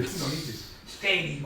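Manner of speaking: ordinary delivery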